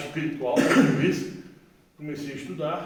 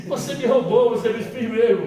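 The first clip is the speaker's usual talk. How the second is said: loud, higher accusing cry of a hurt mother; mock angry accusation; 6 semitones higher, 11 dB louder than usual. Mother accusing her son of robbing her, loud and high. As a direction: throw the blame at him, loud and high.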